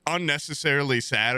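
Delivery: ordinary delivery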